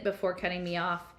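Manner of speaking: usual speaking voice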